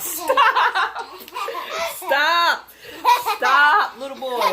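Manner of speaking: real laughter breaking through a loud, high stop; laughing protest. Real, not acted. Speaker to little boy off camera, laughing protest. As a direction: tell him to stop while cracking up.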